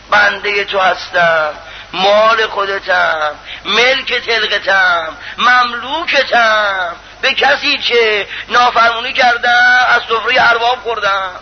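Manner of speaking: slow, stretched-out supplication; humble servant's slow appeal; drawn-out plea. Acted. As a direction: plead with the master, lingering on each phrase. Acts out servant pleading with God.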